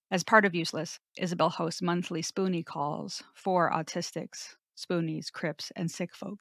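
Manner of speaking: soft, even-paced biography read; scripted guest bio. Acted. Host introduces guest to listeners, soft scripted read.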